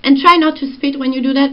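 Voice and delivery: usual speaking voice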